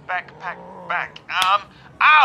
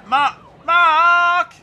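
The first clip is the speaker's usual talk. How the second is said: louder, higher, drawn-out cry; desperate calling out; 6 semitones higher, 6 dB louder than usual. Acted. Man shoved in police van calling Mark, desperate. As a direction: holler the name for help.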